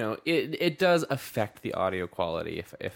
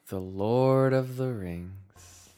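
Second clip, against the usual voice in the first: slow, grand movie title read; dramatic title announcement; 3 dB louder, much slower than usual. Acted. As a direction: intone the title like a trailer.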